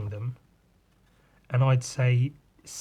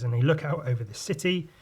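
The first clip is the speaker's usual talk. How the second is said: ordinary talk, nothing marked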